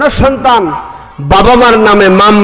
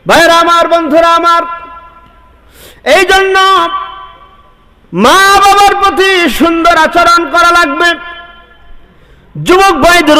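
high, ringing sermon cry; fiery preaching; 9 semitones higher than usual. Acted. Preacher calling to the congregation, fiery and high. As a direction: preach it out to the crowd at full force. Preacher rises to full force.

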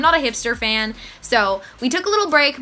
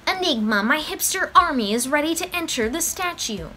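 usual speaking voice

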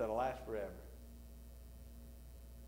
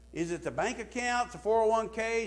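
normal talking voice

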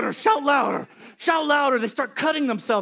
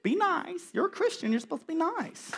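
higher, softer gentle scold; wife voice; 8 semitones higher, 7 dB quieter than usual. Retold or imitated. Wife correcting him, higher and soft. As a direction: lightly mimic a wife's soft reproach. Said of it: "my wife".

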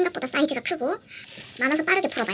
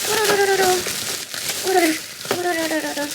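playful trill; 4 semitones higher than usual. Pretend. Playing with the merch for viewers, playful trill. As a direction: trill it like a silly noise. Imitated rolling noise.

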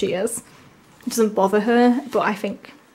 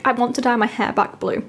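ordinary talk, nothing marked

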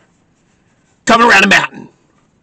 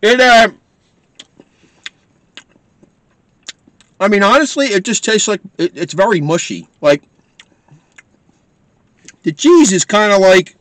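chewing while talking; talking between bites; mouth full of pizza; 3 semitones higher than usual. Real, not acted. Seen 1: Bit pizza at -5; chewing mouth shapes, pizza in hand.

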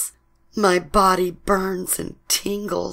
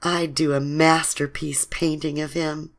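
ordinary delivery

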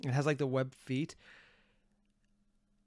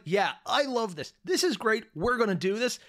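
high, eager fan voice; enthusiastic; 6 semitones higher, 3 dB louder than usual. Retold or imitated. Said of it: "hearing someone is like".

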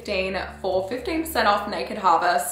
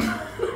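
short laugh; 4 dB quieter than usual. Real, not acted. Laughing at tiny bathtub.

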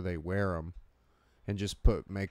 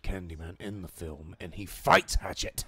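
grand, theatrical movie-villain voice; stately impression, hitting FIGHTS hard; Candyman. Friend pitching Candyman as the movie's hero, commanding impression. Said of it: "I almost changed him to, like, an Englishman".

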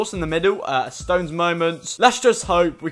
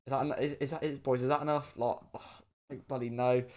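nervous mumble; 4 semitones lower, 13 dB quieter than usual. Real, not acted. Nervously taking soap shot.

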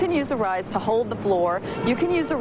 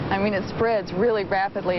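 ordinary talk, nothing marked

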